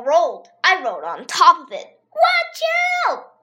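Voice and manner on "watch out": scream. A narrator's reading. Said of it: "Emily screamed".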